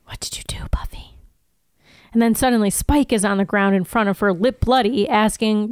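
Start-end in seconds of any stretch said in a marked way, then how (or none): 0.1-1.2 whisper. A narrator's reading. Repeating the haunting whisper.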